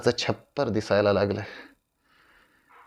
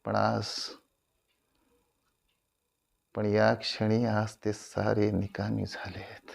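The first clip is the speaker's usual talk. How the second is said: subdued admission; 9 dB quieter than usual. Acted. Actor admitting all his words are useless now, subdued and soft. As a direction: let the voice sink away.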